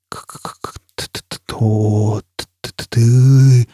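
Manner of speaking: consonants stuttered, vowels dragged out; distorted stuttering echo. A narrator's reading. Foyle's question reaches the burning man as stuttered, stretched sound. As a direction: stammer each sound, then stretch it slowly.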